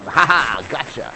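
loud triumphant ha ha; mock gloating queen. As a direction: gloat over the trapped king. Queen gloats, trapped him.

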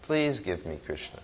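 hushed, longing plea to the devotee; soft prayer. Acted. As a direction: beg for Krishna softly.

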